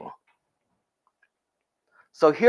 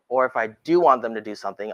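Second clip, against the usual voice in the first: normal talking voice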